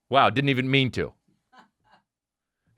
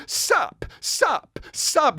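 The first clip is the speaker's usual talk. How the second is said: Nicolas Cage saying sup; 12 semitones higher, much slower than usual. Retold or imitated. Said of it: "and he keeps saying".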